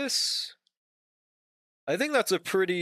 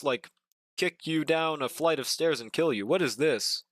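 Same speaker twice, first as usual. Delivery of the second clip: usual speaking voice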